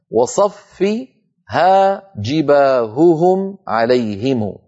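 slow recitation, each ه in "جباههم عليهم" distinct; pure clear ه. Shown as a demonstration. Teacher reciting the poem's line, slowly articulated. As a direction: draw out "جباههم" with clean separate ه sounds. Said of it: "يعني انطقه صافيا".

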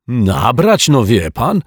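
a caretaker yelling in indignation; indignant shout. A narrator's reading. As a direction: throw back "Nabrać" in offended outrage. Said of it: "krzyknął z oburzeniem pan Mietek".

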